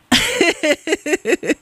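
long, rapid high giggle; tittering happily; giggling laugh. Real, not acted. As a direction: let a string of giggles spill out. Laughs after free love.

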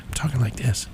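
very soft, barely voiced talk; whispering. Telling his friend how he was talking, whispered. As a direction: imitate talking far too quietly to hear. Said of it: "You're whispering to me".

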